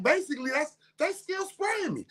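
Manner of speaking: usual speaking voice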